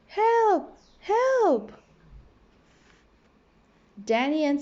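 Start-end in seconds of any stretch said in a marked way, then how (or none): 0.1-1.7 high, urgent calls for help; a frightened plea from far off; cry for help. A narrator's reading. Friends caught by a shark cry out, high and urgent. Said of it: "he heard a cry".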